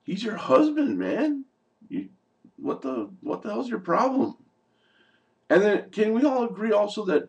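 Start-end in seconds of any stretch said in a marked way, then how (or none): 0.0-4.4 much higher pitched, exasperated rant; indignant disbelief aimed at Lori; scolding Lori from the couch, outraged. Retold or imitated. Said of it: "I was just like".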